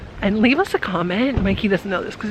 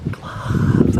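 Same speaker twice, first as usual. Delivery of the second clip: hushed, surprised whisper; whispered surprise. Real, not acted. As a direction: breathe it out in surprise. Repeating find in whisper.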